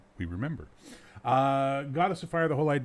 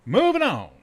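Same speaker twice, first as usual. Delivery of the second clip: emphatic announcement; 13 semitones higher, 12 dB louder than usual. Real, not acted. Host moving on to the next song, called out loudly. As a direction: call it out big to switch topics. Host calls out a topic change.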